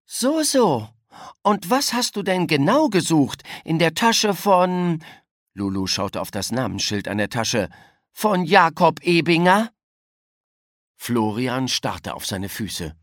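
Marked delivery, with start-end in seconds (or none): 0.0-5.0 high, pointed knowing questioning; suspicious interrogation. A narrator's reading. Child confronts boy caught in act. 8.1-9.7 higher, louder, pointed rise; spring the name on the culprit; incredulous, confronting tone; accusing question. A narrator's reading. Child finishes accusing question.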